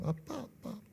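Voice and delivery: small, high child imitation; four-year-old daughter. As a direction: softly mimic a little girl's repeated calls. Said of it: "네 살 때입니다". (어린 딸을 흉내 낸 작고 높은 목소리)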